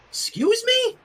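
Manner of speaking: high squeak of disbelief. A narrator's reading. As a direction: squeak it out, caught off guard. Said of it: "Rainbow Dash squeaked".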